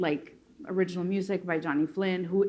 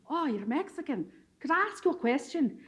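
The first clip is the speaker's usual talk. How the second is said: put-on lilting, higher-pitched Scottish accent; 5 semitones higher than usual. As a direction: imitate an eager Scot asking, in an imperfect Scottish accent. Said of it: "a Scottish accent, but, but it's not that good anymore".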